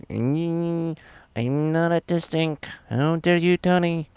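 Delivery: high, offended mock-indignant voice. Streamer mocking his own anger at Tony, high and huffy.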